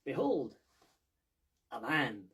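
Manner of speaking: loud, theatrical "behold"; loud mock-Diogenes unveiling; grand proclamation. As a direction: unveil it like a showman. Diogenes parody presentation.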